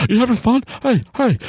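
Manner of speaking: high, excitable squeaky chatter; over-eager pestering. Retold or imitated. Crazy guy on the bus, high and over-eager.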